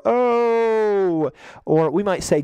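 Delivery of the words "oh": crowd ooh. Retold or imitated. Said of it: "our kids will stand up and go".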